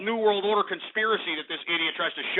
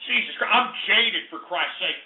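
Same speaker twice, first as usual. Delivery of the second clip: ordinary talk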